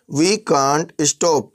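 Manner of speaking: very slow, every word spelled out; slow clear gloss. Shown as a demonstration. Teacher glossing for students, very slow and deliberate. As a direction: say it slowly for learners. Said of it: "teacher glosses phrase for learners".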